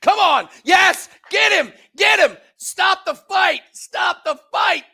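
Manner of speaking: loud high shouting; yelling from the sidelines. Real, not acted. Yelling at the fight.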